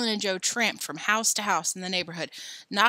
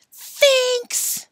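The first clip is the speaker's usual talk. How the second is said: high, loud yell across the street; boy shouting; 10 dB louder than usual. Acted. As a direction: hurl the insult out the window. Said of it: "a boy shouted".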